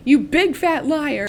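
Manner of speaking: high, slow taunting laugh through the words; mocking laugh. Clove gloats at Katniss, slow, high mocking laugh. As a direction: cackle it at her like a playground bully. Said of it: "Clove is like".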